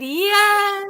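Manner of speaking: high, bright little doll voice; squeaky doll yes. Pretend. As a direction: chirp it like a tiny doll. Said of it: "She said".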